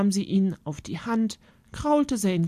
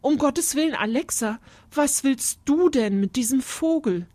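higher, alarmed mother; horrified mother's exclamation, pitched up; startled; 7 semitones higher, 3 dB louder than usual. A narrator's reading. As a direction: protest in acted dismay. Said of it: "fragte Mama erschrocken".

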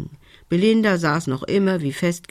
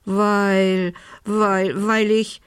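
hesitant, pitched-up stammer; 4 semitones higher, much slower than usual. A narrator's reading. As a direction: stall on a drawn-out "weil", then restart. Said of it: "stotterte Belinda".

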